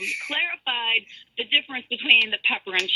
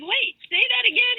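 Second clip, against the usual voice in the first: retold baffled request, pitched much higher; bingung; 13 semitones higher than usual. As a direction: ask again in mock confusion, voice jumping up. Retelling her baffled phone call to agent.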